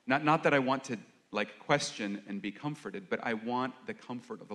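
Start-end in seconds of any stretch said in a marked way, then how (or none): none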